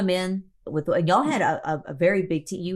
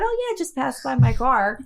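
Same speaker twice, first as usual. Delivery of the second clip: mock calm friend; 6 semitones higher than usual.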